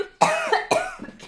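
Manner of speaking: sputtering cough after swallowing tea wrong; real coughing fit from choking on tea; choking on a drink. Real, not acted. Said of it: "ich hab mich voll verschluckt an meinem Tee".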